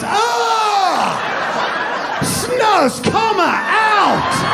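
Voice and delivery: high shouted fake-Swedish call; hammed-up Swedish shout; mock Swedish host. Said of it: "the guy goes".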